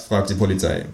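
plain reading voice

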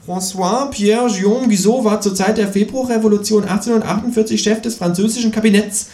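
high lecturing kangaroo voice. A narrator's reading. Kangaroo lectures on evolution in a high voice.

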